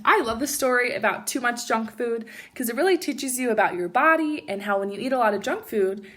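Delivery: plain reading voice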